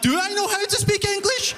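panicked self-doubt. Retold or imitated. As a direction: fret aloud in a high, panicky voice. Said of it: "The whole time I'm like".

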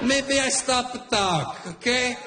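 put-on high, heated Italian accent threats; the Italian dad threatening the man who cut in line. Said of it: "My Italian dad right away".

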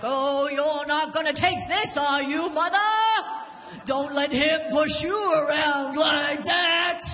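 hammed-up vintage ringside call; old-timey boxing announcer. Drunk daughter between fighting parents, playing old-timey boxing announcer. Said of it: "some kind of old-timey boxing announcer".

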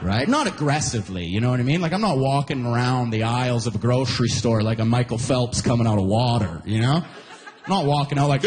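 normal talking voice

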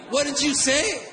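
high-pitched mock challenge; puffed-up duck talking. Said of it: "starts making himself look big".

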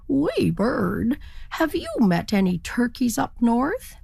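politely asking a small bird; turkey voice. Acted.

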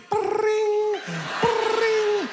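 very high sung ring-ring; imitated phone ring. Mimicking the phone ringing inside his head, very high.

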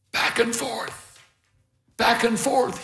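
slow, high, hushed instruction; careful coaching. A narrator's reading. Old beekeeper teaching the smoker swing, careful and measured. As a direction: guide them gently through the motion.

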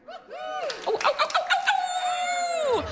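high drawn-out squeal of delight; excited squeal. Real, not acted. Comedian squealing about dogs, excited high squeal.

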